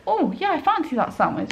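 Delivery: lightly voiced mum, high and breezy; a hint of Mum browsing a menu; imitating Mum. Daughter voices Mum picking a sandwich, lightly imitated. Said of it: "Whereas Mum would just think".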